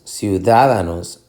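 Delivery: ciudadanos wrongly stressed on the first DA; stress on second syllable. Shown as a demonstration. Teacher testing stress options, second-syllable stress on ciudadanos. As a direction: lean on ciu-DA-da-nos. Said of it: "Tú dices ciudadanos".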